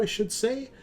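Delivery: ordinary talk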